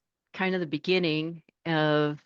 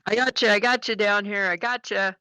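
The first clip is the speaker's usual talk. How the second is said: CB buddy greeting; 6 semitones higher than usual. Retold or imitated. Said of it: "he would say".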